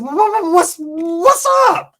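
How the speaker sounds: very high, slow, stretched-out indignant squeak; mock outrage. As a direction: squeal it out slowly, offended. Jealous boyfriend confronts the rival.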